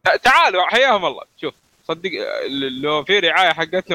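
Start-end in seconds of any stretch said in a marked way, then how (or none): 0.0-1.2 mock eager welcome. Jokingly invites Netflix to sponsor.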